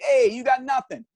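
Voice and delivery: put-on Guido accent. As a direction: brush him off in a Guido accent. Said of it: "with a total, like, Guido accent".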